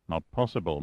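a lightly voiced flat refusal; flatly. A narrator's reading. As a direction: dismiss it without emotion. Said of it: "Massard said flatly".

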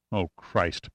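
low, soft murmur; quiet, low-pitched dismay. A narrator's reading. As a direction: murmur it under your breath. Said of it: "Pitt murmured".

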